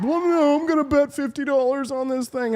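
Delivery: very high caricature voice; silly high-pitched mock protest; mocking young man. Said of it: "He's like".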